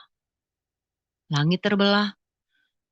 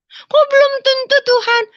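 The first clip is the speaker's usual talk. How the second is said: put-on very high, loud childlike protest; protes kekanak-kanakan; 5 dB louder than usual. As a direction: whine back at God like a child. Childlike protest to God, acted. (protes merengek seperti anak kecil)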